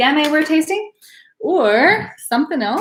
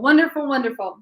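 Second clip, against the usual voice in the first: normal talking voice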